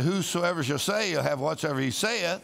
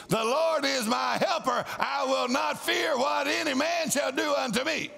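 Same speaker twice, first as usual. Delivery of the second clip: bold declaration; 7 semitones higher than usual. Shown as a demonstration. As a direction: declare it like you mean every word. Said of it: "You may boldly say".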